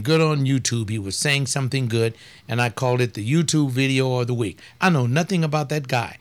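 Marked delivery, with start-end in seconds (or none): none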